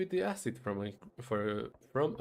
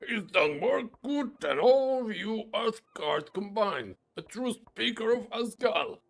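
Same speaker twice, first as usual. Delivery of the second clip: a hint of a dwarf boasting of his hero; 7 semitones higher than usual. Dwarf praises his leader.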